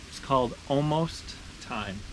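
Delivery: plain reading voice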